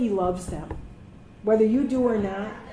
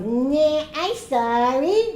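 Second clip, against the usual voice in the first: mock little-kid whine, raised pitch; 5 semitones higher, 3 dB louder, much slower than usual. Doctor apologizing with cans on his head, mock little kid. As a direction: drag it out like a kid. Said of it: "like a little kid".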